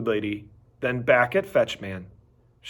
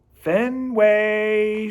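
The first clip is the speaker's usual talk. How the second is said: hopeful call; 7 semitones higher, 3 dB louder than usual. A narrator's reading. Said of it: "she calls in a hopeful voice".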